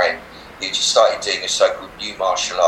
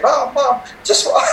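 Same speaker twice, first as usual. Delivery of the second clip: put-on child calling mum; slower than usual. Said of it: "running downstairs and going".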